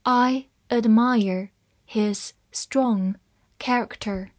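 slow, word by word with small gaps; measured dictation pace; slow reading. Shown as a demonstration. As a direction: read it slowly for learners, each word separate. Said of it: "好，慢慢来读".

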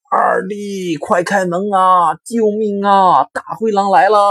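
high, loud, slow yell for help; panicked shouting. Acted. Eldest pig fleeing the wolf, screaming for his brother to open up. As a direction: cry out in terror while running. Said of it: "边跑边喊".

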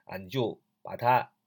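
normal talking voice

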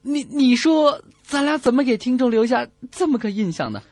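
usual speaking voice

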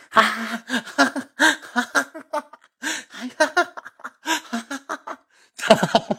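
high-pitched imitated giggling; high stifled ha-ha-ha laughter; mocking classmates' laughter. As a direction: mimic a group laughing at someone. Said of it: "好几个同学搁我旁边就笑".

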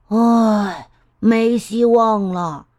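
slow sighing, giving up; heavy sigh of defeat; slow hopeless sigh. A narrator's reading. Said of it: "变色龙唉声叹气地说".

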